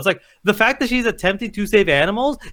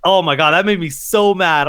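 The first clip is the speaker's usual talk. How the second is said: ordinary delivery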